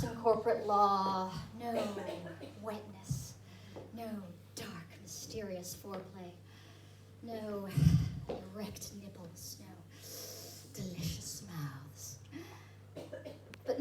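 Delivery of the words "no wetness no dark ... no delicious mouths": very soft, lingering over each item; hushed sensual relish. Acted. Performer lists lost pleasures to audience, hushed and sensual. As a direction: drop low and hushed, savoring the list.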